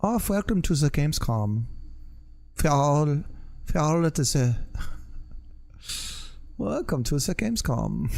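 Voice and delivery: hammed-up German-accented welcome; movie German accent. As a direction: greet them in a bad movie-German accent. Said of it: "That's my movie German accent".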